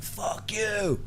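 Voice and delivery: high, soft, cheeky curse; mock Felipe swearing. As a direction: toss it off high and small. Felipe swearing at big guy.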